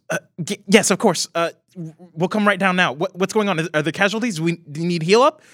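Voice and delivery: flustered, eager to help; young Glenda voice. Acted. Glenda answering the Tin Man, flustered. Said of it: "she kind of goes".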